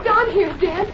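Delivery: high, panicked cries from below; frightened calling for help. Acted. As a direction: call out scared from the water. Fallen into swamp hole, calling.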